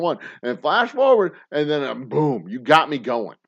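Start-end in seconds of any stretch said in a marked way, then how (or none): none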